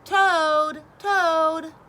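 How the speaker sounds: loud high call of his name; crying out. A narrator's reading. Frog calling to Toad inside the house. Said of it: "cried Frog".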